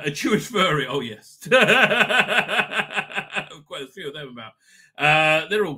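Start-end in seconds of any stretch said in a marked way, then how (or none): 1.6-3.8 laugh. Real, not acted. Laughs at own joke.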